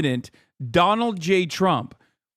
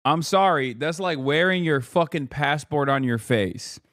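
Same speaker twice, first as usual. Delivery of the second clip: ordinary delivery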